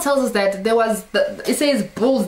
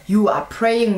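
ordinary talk, nothing marked

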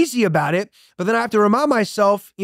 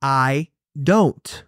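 mentor's calm answer; 4 semitones lower than usual. Retold or imitated. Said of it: "he responded and said".

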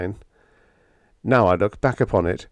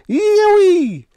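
very high, loud joyful yell; jubilant whoop; 9 dB louder than usual. Acted. Biggs celebrating with Hank, jubilant whoop. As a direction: let out a long whoop.

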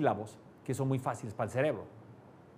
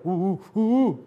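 a bit louder, higher grunts; mild grunts; 4 semitones higher, 6 dB louder, slower than usual. Shown as a demonstration. Teacher contrasts two ways of saying the same grunt. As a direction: grunt it out plainly. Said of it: "si yo te digo".

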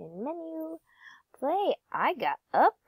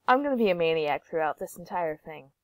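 usual speaking voice

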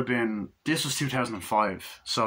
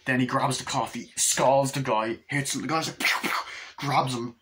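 normal talking voice

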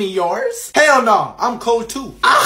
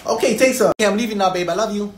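normal talking voice

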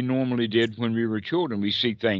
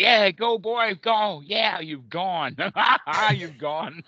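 cheering on; 7 semitones higher, slower than usual. Retold or imitated. Teacher cheering the passing lorry, high and laughing. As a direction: whoop the lorry on its way. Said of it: "we can cheer that lorry".

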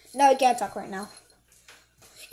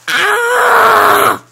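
long, high-pitched yell; loud scream; 6 semitones higher, 13 dB louder than usual. Acted. Trap setter crying out, long loud scream.

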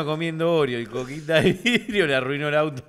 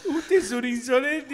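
put-on offended old man; 7 semitones higher than usual. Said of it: "Le dijo".

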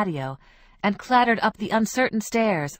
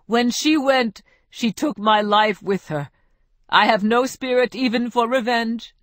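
louder, higher, hammed-up grief; despairing lament; 4 semitones higher, 5 dB louder than usual. Ruben lamenting lost Isabel to friends, louder and higher. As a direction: mourn her like a stage martyr.

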